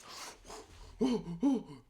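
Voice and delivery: high, exaggerated panicked gasps; imitated gasping. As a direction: mimic someone gasping in shock. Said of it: "P goes like this".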